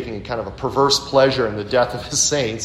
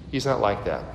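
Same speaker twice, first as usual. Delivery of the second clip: quiet; 4 semitones lower, 6 dB quieter than usual. Shown as a demonstration. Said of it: "No, he's not like that".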